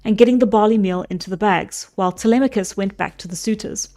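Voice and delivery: steady storybook reading voice; story narration. A narrator's reading. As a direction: read it like an old epic tale. Reads the Odyssey aloud.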